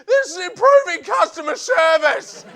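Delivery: loud, high bank thug yelling; banker's shout. Retold or imitated. Bank heavy kicking in comedian's door, shouting. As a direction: bellow it like a smug banker.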